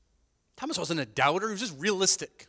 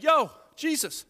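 blunt Thomas; 10 semitones higher, 4 dB louder than usual. Retold or imitated. Thomas questioning Jesus, higher and blunt. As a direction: ask it like a plain-spoken disciple.

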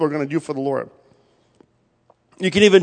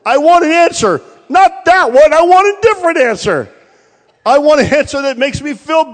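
emphatic; 8 semitones higher, 4 dB louder than usual. Real, not acted. Preacher's emphatic peak.

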